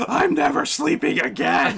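high, slow, laughing fake panic; mock terror. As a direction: squeal it like a scared kid. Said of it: "I was like".

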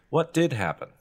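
ordinary talk

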